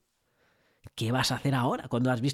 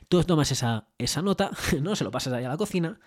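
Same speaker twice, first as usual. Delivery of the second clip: ordinary delivery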